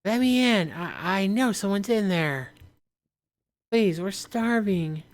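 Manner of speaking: high, begging woman's voice; pleading woman. Acted. Starving woman begging at the door, high plea. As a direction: plead high to be let in, slowing at the end. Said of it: "Woman's outside".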